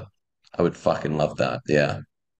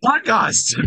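laughing high; 3 dB louder than usual. Real, not acted. Improv punchline, bursts laughing.

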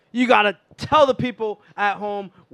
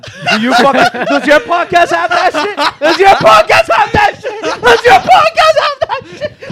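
higher and louder laughing shout; screaming; 13 semitones higher, 10 dB louder than usual. Real, not acted. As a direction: yell it louder each time, laughing. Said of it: "screaming with my lungs".

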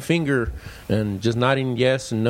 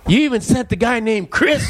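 amazed to God; 10 semitones higher than usual. Retold or imitated. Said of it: "I'm like".

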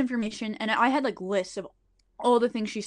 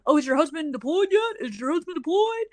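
shrill-free mock taunt, pitched up; mocking yell; 7 semitones higher than usual. Coworker taunting him in the office, pitched-up mock yell. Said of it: "starts yelling about how".

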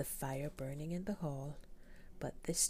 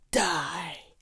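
furious roar; 2 semitones higher, 12 dB louder than usual. Acted. The Beast roars his death sentence at the merchant, full shout. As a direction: bellow the one word at him.

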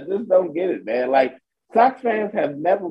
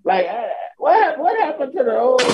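ordinary talk, nothing marked

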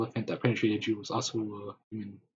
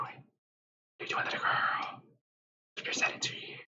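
eerie whisper; 4 dB quieter than usual. Acted. Mime whispering to you at your door, creepy whisper.

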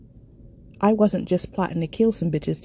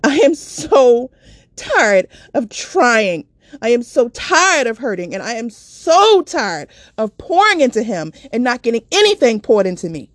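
screamed; 5 semitones higher, 6 dB louder than usual. Retold or imitated. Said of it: "I screamed".

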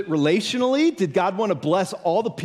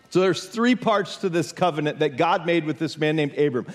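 ordinary talk, nothing marked

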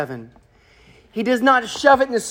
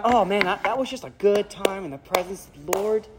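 mock casual; 4 semitones lower, 6 dB quieter than usual. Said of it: "His reaction is not".

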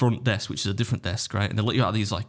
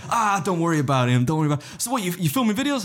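friendly guard; 8 semitones higher than usual. Retold or imitated. Friendly guard imitated, laughing retelling.

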